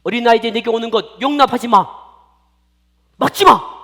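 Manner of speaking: stern rebuke building to a shout; Jesus. Acted. Jesus orders disciples to let children come, angry rebuke. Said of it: "예수님이 막 화를 낸 거예요".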